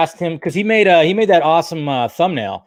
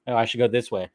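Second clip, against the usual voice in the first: ordinary delivery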